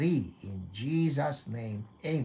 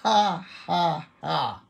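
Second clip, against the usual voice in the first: three separate declared ha's; deliberate forced laugh; 4 semitones higher, 3 dB louder, slower than usual. Shown as a demonstration. Preacher leading the congregation in one round, deliberate laugh. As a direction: lead a round of on-purpose laughter. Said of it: "isang round nga dyan".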